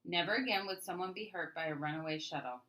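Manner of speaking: plain reading voice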